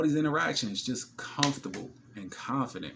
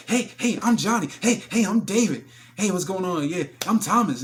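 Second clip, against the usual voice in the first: higher, louder thirsty dudes introducing themselves; eager guys; 8 semitones higher, 9 dB louder than usual. Retold or imitated. Other men crowding her table, overeager introductions. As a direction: ham up the eager suitors lining up. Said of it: "everybody else".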